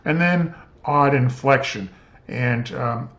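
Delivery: usual speaking voice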